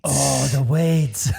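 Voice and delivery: drawn-out mock Aussie echo; Australian accent. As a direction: stretch it slowly in an Australian accent. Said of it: "the Steve Irwin".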